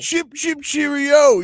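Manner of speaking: hammed-up British cheerio; light mock British accent. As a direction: toss off a jokey British phrase. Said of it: "It's kind of what they sound like".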